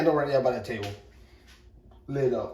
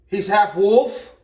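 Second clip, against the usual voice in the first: higher, louder, slow on "half wolf"; amazed emphasis; 6 semitones higher, 9 dB louder, slower than usual. Real, not acted. Bragging about son's dog, amazed and loud.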